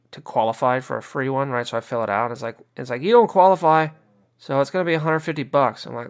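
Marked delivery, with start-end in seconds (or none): 3.0-5.9 annoyed man mocks website rejecting his test. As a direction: play the booking site turning him down, louder. Mock website voice rejecting him.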